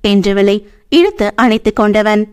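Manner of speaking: ordinary delivery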